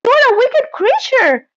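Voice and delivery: high, indignant outburst; angry cry. A narrator's reading. Dorothy to the witch, high indignant cry. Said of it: "cried Dorothy".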